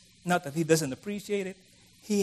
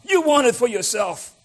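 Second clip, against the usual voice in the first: high, loud accusing Bilbo voice; 8 semitones higher, 9 dB louder than usual. Retold or imitated. Bilbo accuses Gandalf of wanting the ring, high and loud. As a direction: snap it like a suspicious hobbit. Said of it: "he accused Gandalf".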